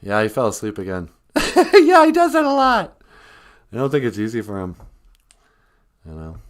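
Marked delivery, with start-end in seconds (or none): 1.7-3.0 very high, loud sidekick voice; high-voiced sidekick. Sidekick agrees with host about the sleeping guest, high character voice. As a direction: squeal it out in a high, excited character voice.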